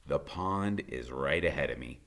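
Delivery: plain reading voice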